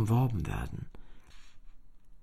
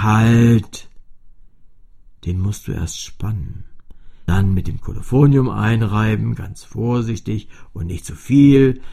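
put-on a strict old instrument barking orders; stern cello; 2 semitones lower, 11 dB louder than usual. A narrator's reading. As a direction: call out to stop the girl, then instruct firmly. The cello stops and instructs Fini.

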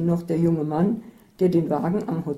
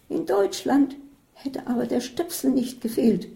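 Isabel's retort; 7 semitones higher than usual. A narrator's reading. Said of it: "antwortete Isabel".

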